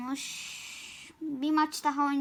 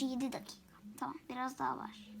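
whisper; 2 semitones lower, 10 dB quieter, faster than usual. Real, not acted. Quick whispered time check.

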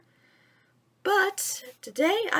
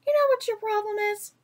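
put-on much higher cheeky boy's voice; 9 semitones higher, faster than usual. Mowgli teasing Bagheera, high boy's voice. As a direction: tease him as the boy. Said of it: "Mowgli said".

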